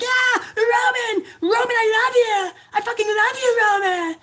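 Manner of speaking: very high mock squeal; hammed-up falsetto fan cry; adoring fans. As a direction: imitate a hysterical fan screaming with devotion. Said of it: "I'm sure there's his fans".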